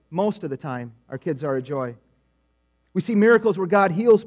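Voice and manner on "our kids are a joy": wry aside. Shown as a demonstration. Wry repeat after qualifier.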